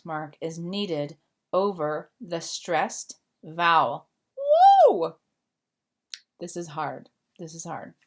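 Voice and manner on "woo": woo. Real, not acted. Teacher reacting to a hard rule, drawn-out woo. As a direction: let out a long woo.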